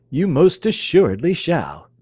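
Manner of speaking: loud, high commanding father; stern father. Acted. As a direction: lay down the law, loudly. Toobad overrules his daughter.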